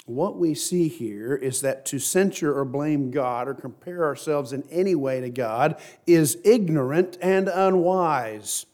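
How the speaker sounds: ordinary talk, nothing marked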